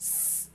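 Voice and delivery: স- as a lone sustained hiss of s; extended s. Shown as a demonstration. Teacher showing the sound that must stay, long s. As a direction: hold the s of স- without a vowel. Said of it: "বেশি করে".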